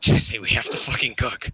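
lightly put-on husky impression; Heisenberg. Telling Jesse to cook, husky impression. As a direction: urge a partner in a husky character voice.

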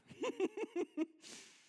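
very high, loud peal of laughter; real laugh. Real, not acted. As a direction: crack up, long and high. Laughs after reacting to next lens.

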